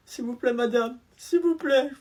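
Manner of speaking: high, pleading whine near tears; begging. Acted. Pupil begging the teacher not to tell his mother, whining. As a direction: plead like a kid about to cry.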